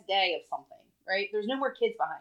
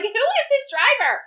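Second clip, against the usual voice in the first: incredulous outburst; 7 dB louder than usual. Retold or imitated. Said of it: "We're like".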